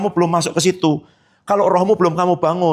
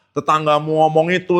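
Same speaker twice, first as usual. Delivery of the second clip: ordinary talk, nothing marked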